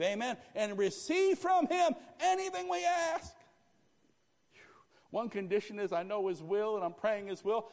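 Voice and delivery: high, loud preaching; preaching at full force. Real, not acted. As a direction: drive it home at full cry. Preaching climax.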